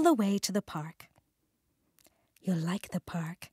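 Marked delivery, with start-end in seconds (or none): 2.4-3.5 soft whisper to a pet. Acted. Jake whispers to Norman on the way, softly. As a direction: hush it to the frog in the box. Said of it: "Jake whispered to Norman".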